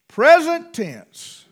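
slow, loud, high "Present tense"; loud, high, slow "Present tense" as a preaching point; emphatic proclamation. Real, not acted. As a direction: hammer it home.

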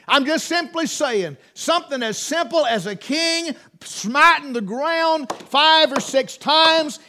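high, loud preaching build; fiery pulpit climb; preaching at full force. Acted. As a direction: preach it out with rising fire.

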